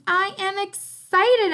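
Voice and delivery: loud, high, drawn-out excitement; excited. Shown as a demonstration. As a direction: read it like an exclamation mark, big and slow. Reading a sentence with exclamation mark.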